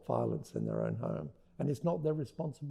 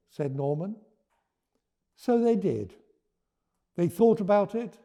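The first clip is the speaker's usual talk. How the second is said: ordinary delivery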